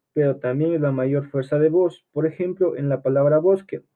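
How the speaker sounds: ordinary talk, nothing marked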